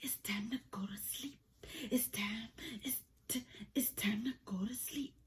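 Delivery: hushed bedtime whisper. Real, not acted. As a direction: whisper it softly, lights out.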